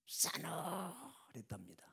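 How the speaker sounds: hushed unvoiced whisper. Preacher hushing the congregation about the chill: whisper. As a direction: breathe it out like a secret. Chilly mood after president's anger.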